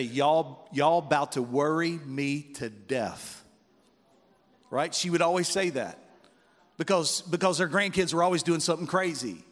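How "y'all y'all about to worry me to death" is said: slow Southern 'bout to worry me to death; fond drawl of a scolding granny; grandmother's Southern drawl. Retold or imitated. Preacher imitating his grandmother's Southern scolding, slow drawl. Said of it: "She'd say".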